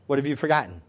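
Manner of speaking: normal talking voice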